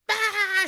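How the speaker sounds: very high mock shriek; mock scream. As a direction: shriek it like a startled masseuse. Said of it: "and she goes".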